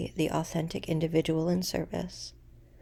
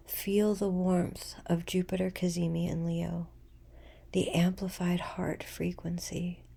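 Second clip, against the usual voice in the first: guided meditation; 4 dB quieter than usual. Acted. Guiding listeners into a full moon breathing practice, meditative.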